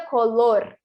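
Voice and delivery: "color" with a single tapped R; teacher demo of "color"; Spanish tapped R. Shown as a demonstration. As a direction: say "color" with one quick tongue tap on the R. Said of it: "no espanhol vai ser vibrante".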